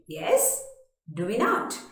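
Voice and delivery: usual speaking voice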